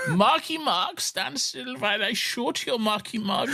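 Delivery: high, drawn-out cartoon German; German accent. Soldier ordering Marky Mark to stand still, hammed-up German accent. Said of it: "Like, a stereotypical one".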